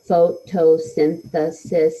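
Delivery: "photosynthesis" tapped out in separate beats; syllable by syllable. Shown as a demonstration. Teacher shows tapping on the desk, "photosynthesis" beat by beat. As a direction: break "photosynthesis" evenly into its five syllables. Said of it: "they might tap like".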